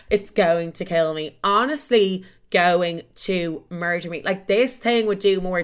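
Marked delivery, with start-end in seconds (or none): none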